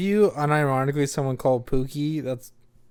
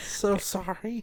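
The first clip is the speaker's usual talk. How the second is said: Jennifer Coolidge impression; 7 semitones higher, 5 dB quieter, much slower than usual. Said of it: "It's like she has a lisp".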